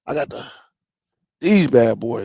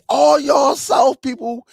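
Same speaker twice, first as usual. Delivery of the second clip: teasing call-out; 10 semitones higher than usual. Real, not acted. Teasing southern viewers, calling out.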